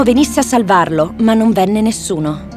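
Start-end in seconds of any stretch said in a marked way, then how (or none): none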